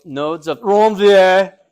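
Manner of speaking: exaggerated, drawn-out French Ranvier, loud; big slow French flourish on Ranvier; best French accent. Shown as a demonstration. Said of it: "You gotta try your best French".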